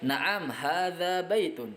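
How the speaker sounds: Naam, hadza baitun drawn out slowly and falling; teacher modelling Arabic answer; slow model answer. Shown as a demonstration. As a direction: answer slowly for students to repeat. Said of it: "Ya, ini adalah rumah".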